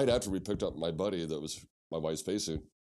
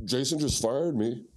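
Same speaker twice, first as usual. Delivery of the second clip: slow, higher, louder tattling; slowly whined "Jason just fired me"; whiny complaint; 5 semitones higher, 5 dB louder, slower than usual. Retold or imitated. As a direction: tattle to the manager. Employee complains to manager.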